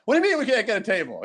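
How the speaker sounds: high, fast indignant protest; incredulous high-pitched complaint; Eddie Murphy. Retold or imitated. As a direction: demand an answer, voice shooting up. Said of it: "Eddie Murphy's like".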